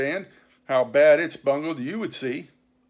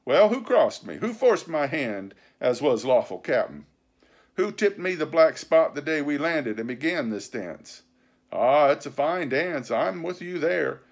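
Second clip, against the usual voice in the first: loud, raised pirate tirade; vehement; 5 semitones higher, 6 dB louder than usual. A narrator's reading. Silver answering the mutineers' charges, vehement. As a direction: pound out the argument indignantly. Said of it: "talking with a vehemence that shook the house".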